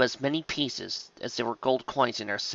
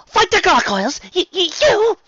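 very high, loud incredulous stammer; shocked stammer; 9 dB louder than usual. A narrator's reading. Rarity aghast that Spike would fight, shocked stammer. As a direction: stumble over the word in shrieky disbelief. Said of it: "she stammered".

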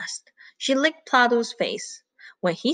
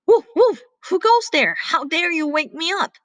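barking dog; 8 semitones higher than usual. Acted. Woken farm dog challenges the intruder, barking. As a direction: bark it out, then ease the volume. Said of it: "came out of the kennel and barked".

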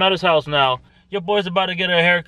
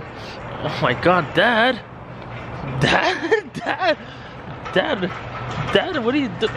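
startled call; 5 semitones higher, 3 dB quieter, slower than usual. Real, not acted. Startled, calling to dad.

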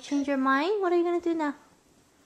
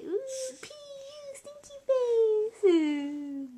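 high, slow, whining mock cry on "Ooh, pee, stinky face"; mock disgusted whine; 11 semitones higher, much slower than usual. Shown as a demonstration. Mom showing her daughter how Stinky whines, a high slow mock cry. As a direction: stretch "stinky face" into a slow fake sob. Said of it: "Stinky say".

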